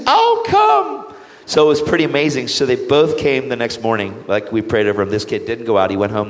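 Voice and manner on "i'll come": very high, louder mother's voice; imitating an eager mother; eager mom. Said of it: "She goes".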